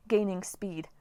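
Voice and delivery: ordinary delivery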